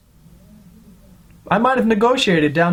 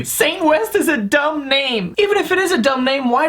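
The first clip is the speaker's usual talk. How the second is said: whiny critic voice; 6 semitones higher than usual. Retold or imitated. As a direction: drag it out like a snooty hater.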